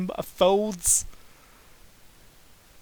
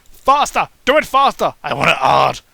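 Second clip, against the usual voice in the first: yelling; 10 dB louder than usual. Acted. The character yelling at her partner, loud. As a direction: shout the demand. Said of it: "she yells".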